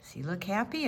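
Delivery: usual speaking voice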